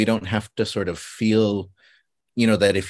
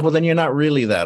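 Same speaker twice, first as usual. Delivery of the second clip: louder, high-pitched challenge; challenging friend; 7 semitones higher, 5 dB louder than usual. Retold or imitated. Friend disputing that he is really Chamorro, challenging. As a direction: push back in a raised, doubting voice.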